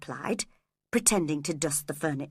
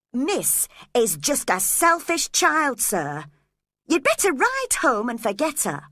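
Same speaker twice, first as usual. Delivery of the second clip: calling out; 6 semitones higher, 4 dB louder than usual. A narrator's reading. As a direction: urge him to leave. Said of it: "I called out to him".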